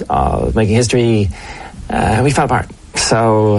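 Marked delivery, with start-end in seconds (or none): none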